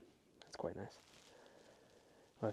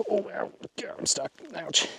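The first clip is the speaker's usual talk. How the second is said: ouch; 8 semitones higher, 9 dB louder than usual. Real, not acted. Gets stuck and hurts himself.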